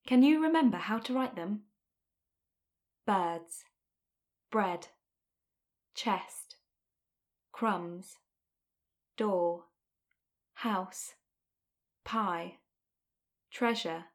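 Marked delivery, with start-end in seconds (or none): 3.1-14.1 slow, low, separate words; each word alone, falling; word list reading. Shown as a demonstration. Reads picture dictionary words.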